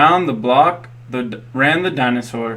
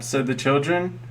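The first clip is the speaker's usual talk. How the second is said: plain reading voice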